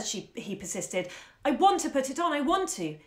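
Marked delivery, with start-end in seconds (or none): 1.4-3.0 put-on high, pushy little boy; childish insisting; insistent child. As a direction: insist like a stubborn small boy.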